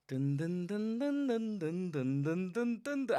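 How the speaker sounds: rhythmic mock strumming sounds; imitated guitar strum. Shown as a demonstration. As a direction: hum out the strum beat by beat. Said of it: "it's gonna go".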